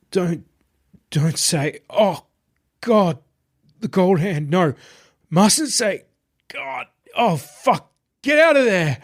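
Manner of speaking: high, frantic babbling in terror; high, broken, panicked pleading; terrified gibbering. A narrator's reading. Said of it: "Marius's gibbering continued".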